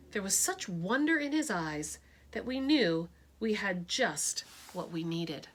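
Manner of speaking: usual speaking voice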